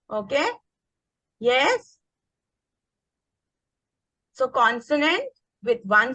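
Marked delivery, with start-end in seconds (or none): none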